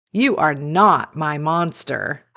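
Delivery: ordinary delivery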